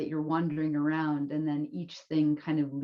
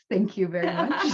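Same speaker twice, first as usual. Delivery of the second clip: laughing; 11 semitones higher, 5 dB louder than usual. Real, not acted. Laughing thanks after joke.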